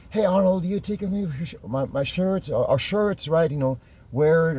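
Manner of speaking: high-pitched imitation of an eager rep; retold eager pitch. Company rep offering Arnold free shirts, retold eager pitch. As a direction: call out brightly, pitched well up, re-enacting it.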